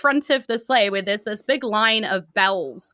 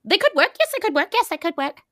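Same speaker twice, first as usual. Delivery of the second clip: much higher, musing, sizing them up; high voice weighing the newcomers; high appraising voice; 12 semitones higher than usual. Acted. GM voices Santa appraising party.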